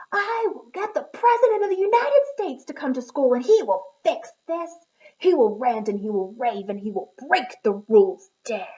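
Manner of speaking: screamed tantrum. Acted. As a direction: scream it like a kid in a full tantrum. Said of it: "He screamed".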